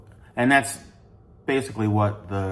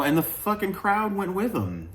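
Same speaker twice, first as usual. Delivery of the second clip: exasperated disbelief; 11 semitones higher than usual. Real, not acted. Crowd walks out of the show.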